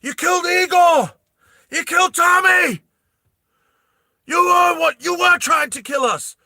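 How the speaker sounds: furious shout. Acted. Vash screaming at Bella about his dead friends. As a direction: scream the accusation at her. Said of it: "then Vash goes".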